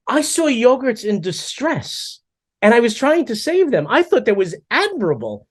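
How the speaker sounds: high, slow, self-righteous plea; mock heroic defense. Husband defending himself to wife, mock-proud and high. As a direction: act like a noble rescuer. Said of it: "I go".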